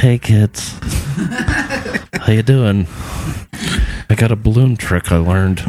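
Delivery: put-on creepy van guy luring kids; sweet creepy coaxing. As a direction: sweet-talk like a creepy stranger. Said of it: "a guy in a van down by the river".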